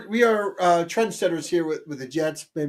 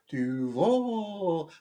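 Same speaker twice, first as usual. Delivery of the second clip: "Duval" murmured softer in a hint of the coach's creepy voice; creepy Duval echo; 7 dB quieter than usual. Host replays the coach's creepy Duval, a soft mock. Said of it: "I just have it in my head now".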